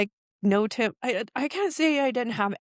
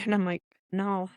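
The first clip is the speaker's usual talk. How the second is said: ordinary talk, nothing marked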